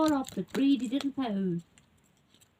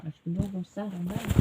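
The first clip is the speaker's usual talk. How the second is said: plain reading voice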